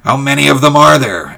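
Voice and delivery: high, loud eager question; eager call. Acted. George calls out question.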